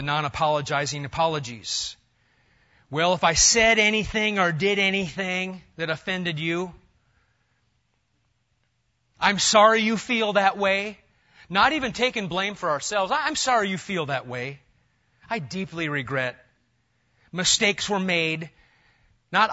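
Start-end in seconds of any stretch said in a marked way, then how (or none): 2.9-11.0 loud, sneering fake sorry; a mocking insincere apology; mock non-apology. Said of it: "Non-apology apologies". 13.1-18.5 loud, sneering fake sorry; a mocking insincere apology; mock non-apology. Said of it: "Non-apology apologies".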